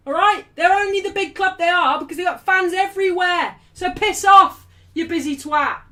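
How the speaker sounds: loud, high-pitched angry crash-out; going off on him. Real, not acted. Streamer snapping at a chatter over football loyalty, angry rant. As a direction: lay into the commenter at full volume. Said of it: "she went off on him".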